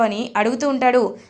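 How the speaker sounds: normal talking voice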